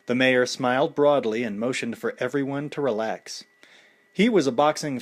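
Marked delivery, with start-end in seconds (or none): none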